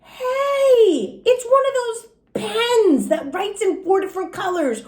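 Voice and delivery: put-on high, bright thrilled little-girl cry; excited discovery. Junie B. finds a four-color pen, thrilled and high. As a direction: squeal it like a found treasure.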